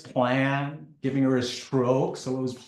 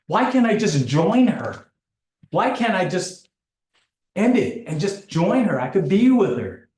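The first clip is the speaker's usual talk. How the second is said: higher, louder pleading questions of despair; despairing inner voice; 6 semitones higher, 5 dB louder than usual. Retold or imitated. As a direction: ask it as if begging to be let go. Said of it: "I was thinking to myself".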